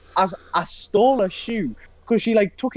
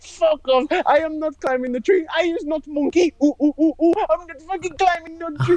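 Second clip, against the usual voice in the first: high-pitched mock tantrum of a friend; hammed-up high protest with monkey hoots; Levente; 11 semitones higher than usual. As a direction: imitate an indignant refusal, pitched way up and breaking into laughter. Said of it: "he walked away from them and went".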